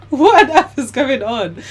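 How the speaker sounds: loud, high, laughing exclamation; laughing bewilderment. Real, not acted. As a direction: burst out in amused disbelief. Laughing at absurd outcome.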